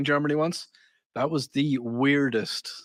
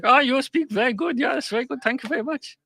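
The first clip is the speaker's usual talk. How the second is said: high, bright mock German voice, much higher; German accent; 10 semitones higher than usual. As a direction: imitate an impressed German local, pitched up.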